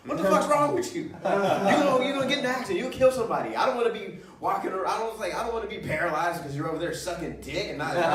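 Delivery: high, loud panicked passenger yelling through a laugh; hammed-up shout at a reckless driver; freaked-out yell. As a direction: passenger squeals in alarm at the driver's behaviour, laughing as he yells. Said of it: "And the dude was like".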